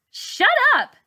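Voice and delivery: high, loud shout; yell. A narrator's reading. Char yelling at the teasing boys, loud. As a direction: yell it at him. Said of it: "Char yells".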